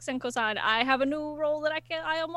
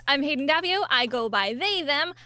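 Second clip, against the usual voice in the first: normal talking voice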